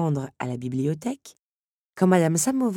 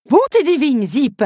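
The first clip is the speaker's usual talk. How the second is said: much higher, much louder exasperated telling-off; rebuke; 11 semitones higher, 12 dB louder than usual. Acted. Mother rebukes Zip for shouting. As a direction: snap at the child in exasperation. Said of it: "le rabroua sa mère".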